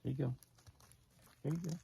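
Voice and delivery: high, soft offering voice; coaxing call to deer. Real, not acted. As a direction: offer the food gently, up high.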